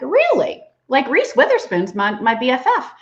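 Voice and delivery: a high, loud, mock-astonished "Really?"; mock surprise. Answering her daughter with loud, high mock surprise. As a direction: act wide-eyed surprise at the news.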